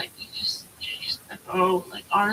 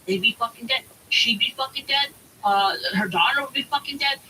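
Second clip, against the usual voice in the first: ordinary talk, nothing marked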